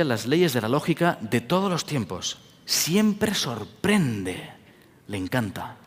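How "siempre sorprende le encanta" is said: very slow, soft wonder; awed slow emphasis. Acted. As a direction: draw out siempre sorprende in awe. (muy lento y suave, con asombro)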